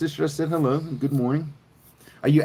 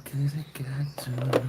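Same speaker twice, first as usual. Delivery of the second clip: unvoiced pleading whisper; 6 dB quieter than usual. Real, not acted. Pleading with the viewer to tell him, whispered. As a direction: breathe it out, begging to know.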